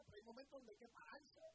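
normal talking voice

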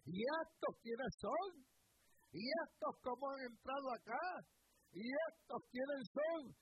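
high, loud preacher's acting of amazement; astonished questioning. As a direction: cry out the question as Juan, drawn out. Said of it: "Y Juan dice". (preguntas de asombro, actuadas en voz alta)

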